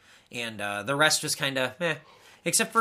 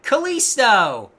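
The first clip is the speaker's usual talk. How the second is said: incredulous listing; 6 semitones higher, 6 dB louder, slower than usual. Real, not acted. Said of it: "mocking roster list".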